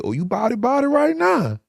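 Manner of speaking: mock him like a cruel guard. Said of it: "they had the audacity to say".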